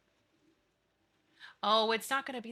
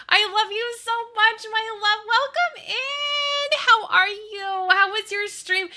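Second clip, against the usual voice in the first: gushing; 12 semitones higher, 8 dB louder than usual. Real, not acted. Streamer gushing to her friend who raided, high and loud. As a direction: pour affection over her friend.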